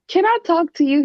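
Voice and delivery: a lightly put-on baby voice to win him over. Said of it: "use your baby voice".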